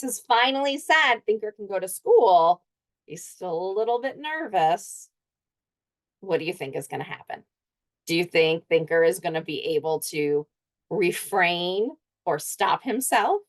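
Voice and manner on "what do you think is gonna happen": usual speaking voice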